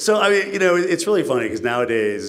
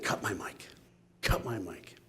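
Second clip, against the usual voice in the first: quiet aside; 11 dB quieter than usual. Retold or imitated. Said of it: "essentially said".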